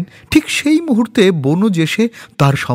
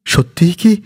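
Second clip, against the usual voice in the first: choked voice; 7 semitones higher than usual. Acted. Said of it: "ধরা গলায় তিনি জিজ্ঞাসা করেন".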